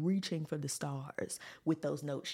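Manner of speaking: ordinary delivery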